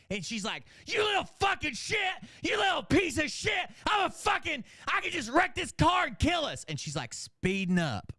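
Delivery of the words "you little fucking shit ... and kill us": very high, shouted mock tirade; screaming rage. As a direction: imitate a mother screaming threats at her son. Mom raging at son while driving.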